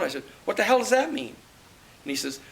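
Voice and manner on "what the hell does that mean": retold high-pitched disbelief, a bit louder; a startled "what the hell" jump in voice; incredulous outburst. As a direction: pitch shoots up in shocked disbelief. Retold outraged question on phone.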